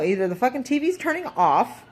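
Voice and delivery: ordinary talk, nothing marked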